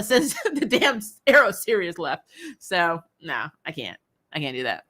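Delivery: usual speaking voice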